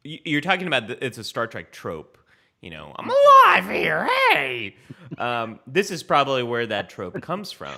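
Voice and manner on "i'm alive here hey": very high, loud, indignant alien cry; aggrieved alien voice. Acted. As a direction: shriek like a stepped-on creature. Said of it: "the new aggrieved, undiscovered alien voice".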